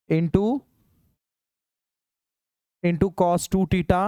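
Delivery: very slow, word by word; slow dictation. Shown as a demonstration. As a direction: dictate each term slowly for notes. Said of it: "teacher dictates formula slowly". (धीरे-धीरे लिखवाना)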